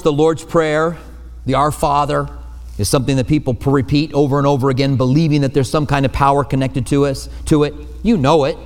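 ordinary delivery

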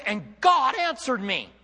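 slow, high, loud amazement; shocked. Acted. Preacher voicing Jonah rescued in the whale, loudly amazed. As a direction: proclaim it in astonishment, stretching the words. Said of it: "with a little bit of a shock".

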